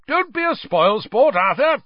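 high, loud jovial boom; hearty booming interruption. A narrator's reading. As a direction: cut in loudly and cheerily. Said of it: "boomed Ludo Bagman".

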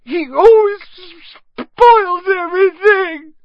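slow, very high, loud wail; pretend sobbing yell. A narrator's reading. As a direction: bawl it out like a spoiled brat. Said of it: "Dudley yelled between huge pretend sobs".

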